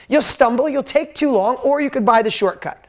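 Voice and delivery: ordinary talk, nothing marked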